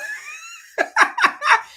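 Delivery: loud, high burst of real laughter; high-pitched laugh. Real, not acted. Host reacts to viewer who turned it off, high laugh. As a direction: crack up in a high, squealing laugh.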